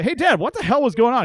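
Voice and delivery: a lively call to dad; asking dad. Retold or imitated. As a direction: son asking his dad what happened. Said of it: "I say".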